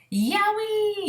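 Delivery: high yelp of pain; yelled. Acted. BFG yelping as Sophie tickles his ear, high yell. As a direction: shriek it out. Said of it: "yelled the B F G".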